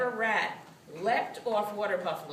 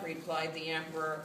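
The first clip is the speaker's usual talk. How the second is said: ordinary delivery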